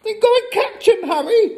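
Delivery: high, fast, loud urging; frantic mother ordering her husband; urgent hiss. A narrator's reading. Mother sends her husband after burglars, urgent hiss. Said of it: "hissed the mother".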